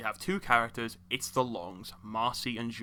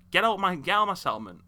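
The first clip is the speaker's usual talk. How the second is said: higher, louder dismissal; exasperated, raised-voice command; mock angry order; 7 semitones higher, 5 dB louder than usual. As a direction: order him out, fed up. Mock ordering Preston away.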